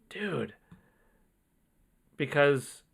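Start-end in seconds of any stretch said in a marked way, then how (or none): 0.1-0.5 awed whisper. Real, not acted. Awed pause after donation.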